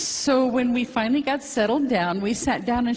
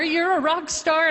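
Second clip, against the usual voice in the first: repeating the doctor's praise, still much higher; excited doctor repeated; 9 semitones higher, 3 dB louder than usual. Retold or imitated. Doctor praising her test results, excited repeat. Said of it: "He came running into the office".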